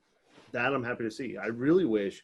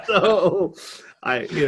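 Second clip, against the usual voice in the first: laughing; 9 semitones higher, 10 dB louder, much slower than usual. Real, not acted.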